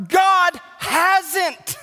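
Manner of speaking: loud, high preaching shout; shouted command. Real, not acted. Pastor ordering complacent members to stop, shouting. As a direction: thunder it from the pulpit. Pulpit climax.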